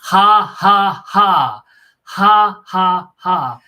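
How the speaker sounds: loud, high, spaced-out "ha"s; forced mock laugh. Bunkmate punished for laughing, loud forced laugh. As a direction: bellow fake laughter on orders. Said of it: "at the top of his lungs, had to laugh like".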